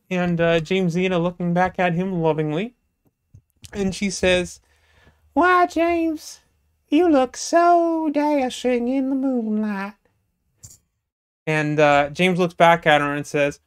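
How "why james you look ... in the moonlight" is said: put-on high, prim falsetto, like a coy young lady. Jamesina speaking to James, in a high falsetto lady voice. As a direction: play a coy Southern belle up high.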